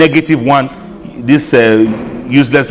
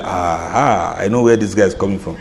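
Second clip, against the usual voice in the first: knowing aha; 9 semitones lower, 6 dB quieter than usual. Retold or imitated. Recognizing a singer's voice.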